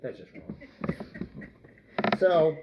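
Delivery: usual speaking voice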